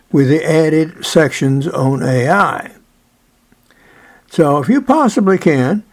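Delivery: loud, eager sales pitch; ad read. Acted. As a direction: pitch the book like a commercial. Loud book promo read.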